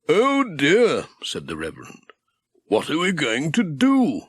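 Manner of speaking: a fussing clergyman at a loss; fretting vicar. A narrator's reading.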